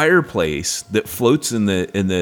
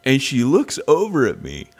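usual speaking voice

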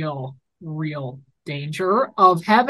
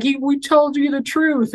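pleading; 8 semitones higher than usual. Retold or imitated. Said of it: "and he's like".

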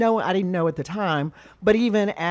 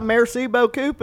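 imitating dad's Texan French, higher; Texan-accented French; 6 semitones higher than usual. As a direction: mangle the French with a Texas accent. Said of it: "that sounds like me".

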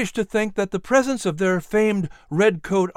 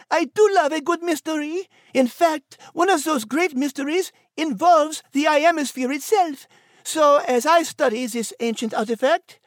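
put-on much higher French accent, delighted; French-accented enthusiasm; 12 semitones higher than usual. Nigel turns to the artifact, high French accent. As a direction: savour the mystery in French accent.

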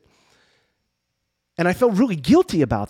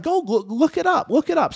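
eager urging; 11 semitones higher than usual. Retold or imitated. Dan urging him to Google.

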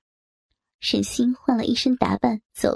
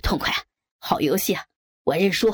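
lower-pitched male character voice; Zhao the boss calling out; 5 semitones lower than usual. A narrator's reading. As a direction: drop low for a man conceding the game. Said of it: "赵总叫道".

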